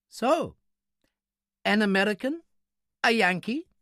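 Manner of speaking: high, precise questioning voice; little man, intrigued. A narrator's reading. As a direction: probe with high, lifted questions.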